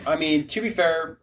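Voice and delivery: ordinary talk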